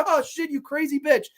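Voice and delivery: high-pitched imitated alarm; mock panicked victim. Wounded husband in the corner to his shooting wife, mock panic. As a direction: yelp it like a cornered victim, played for laughs. Acting the shot husband's reaction.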